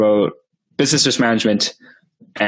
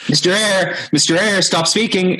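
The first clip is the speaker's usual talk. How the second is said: high, raised imitation of someone interrupting; insistent calling; 10 semitones higher than usual. Voice in his headphones stopping the speaker, insistent and high. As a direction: call out the name higher, cutting in. Quiet interruption escalates to a call.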